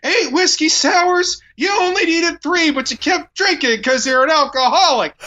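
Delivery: high, strained mock self-scolding; self-loathing rant. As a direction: berate yourself in a pinched high voice. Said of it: "He's like".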